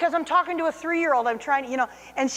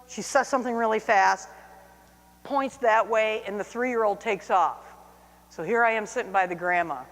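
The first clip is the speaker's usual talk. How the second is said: ordinary talk, nothing marked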